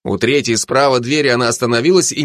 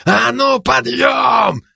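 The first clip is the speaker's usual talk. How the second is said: gruff shout; 13 semitones higher, much slower than usual. A narrator's reading. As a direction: bark the wake-up order. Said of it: "почти басом прорычала она".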